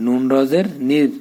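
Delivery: normal talking voice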